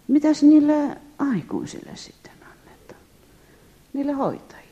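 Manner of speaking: a high whispered question to herself; whispered worry. Retold or imitated. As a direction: wonder aloud under the breath.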